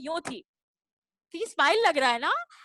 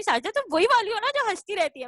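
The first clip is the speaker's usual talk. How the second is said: a bit higher, quicker and louder, teasing recognition; old teacher recalling the giggly pupil; 6 semitones higher, 3 dB louder, faster than usual. Retold or imitated. Teacher greets her former student, teasing and brisk. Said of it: "कहती है".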